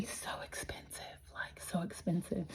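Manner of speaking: hushed, voiceless confiding; whispered aside. Real, not acted. A gardener confiding to viewers how pricey flowers are, whispered. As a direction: drop to a conspiratorial whisper.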